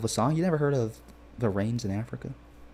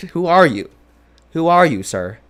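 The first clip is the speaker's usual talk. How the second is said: incredulous; 7 semitones higher, 9 dB louder than usual. Retold or imitated. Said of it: "You're like".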